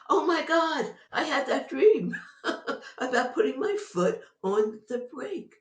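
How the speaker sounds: loud, high, laughing "Oh my God"; excited realization. Real, not acted. Realizing her dream came true, a loud laughing outburst. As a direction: burst out in laughing disbelief.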